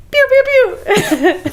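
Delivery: high mock gun sounds; playful pew pew with finger guns; finger gun noises. Cohost mimics finger guns while teasing the host. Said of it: "with finger guns".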